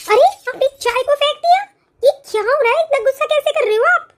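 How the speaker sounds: high, startled cry of disbelief; can't believe the tea was thrown; shocked bride. Acted.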